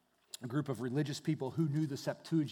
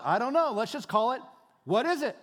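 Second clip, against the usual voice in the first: louder, higher playful shrug; name it with a comic shrug; cheerful naming; 7 semitones higher, 6 dB louder than usual. Acted. Israelites puzzling over manna.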